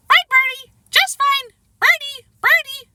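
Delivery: high, loud squawking parrot voice; squawky parrot voice. A narrator's reading. Boy mimicking his parrot, squawky high parrot voice. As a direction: squawk it out like a parrot, well above the child's voice. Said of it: "Fudge laughed".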